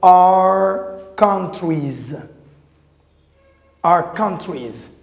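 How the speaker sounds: are countries drawn out very slowly; slow dictation of are countries; slow teaching drill. Shown as a demonstration. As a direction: stretch out are countries for the class.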